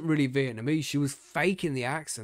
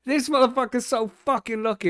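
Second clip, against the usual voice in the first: much higher and louder mock outrage; higher, louder, laughing outrage at the friend's luck; exaggerated outcry; 11 semitones higher, 6 dB louder than usual. Real, not acted. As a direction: blow it up into a big comic wail. Repeats complaint, laughing, bigger.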